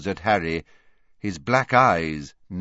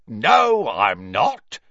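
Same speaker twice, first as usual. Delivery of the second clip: Filch, retorting; 2 semitones higher, 5 dB louder, much slower than usual. Acted. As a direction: hit back loudly as the caretaker. Filch retorts to caught Malfoy.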